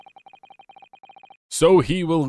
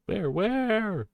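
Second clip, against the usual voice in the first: a much higher, softer suspicious query; mock German guard; 10 semitones higher, 4 dB quieter than usual. Player voices a suspicious German soldier, mock German voice. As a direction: play the suspicious soldier lightly. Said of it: "a cold in nineteen forties Germany".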